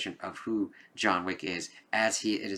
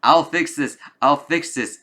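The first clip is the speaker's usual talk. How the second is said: panicky son; 6 semitones higher, 9 dB louder than usual. Retold or imitated. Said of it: "his son's like".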